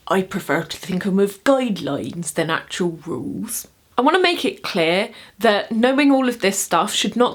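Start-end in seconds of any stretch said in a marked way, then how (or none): 0.1-3.7 lower, quieter put-on pirate voice; Barbossa. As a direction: impersonate the pirate captain, pitched down. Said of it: "from Pirates of the Caribbean where Barbossa".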